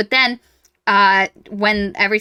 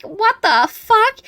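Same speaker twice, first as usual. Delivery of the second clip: high, slow drawn-out what the fuck; stunned disbelief; 13 semitones higher, 5 dB louder, much slower than usual. Retold or imitated. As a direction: let the shock stretch out. Reader's shocked reaction.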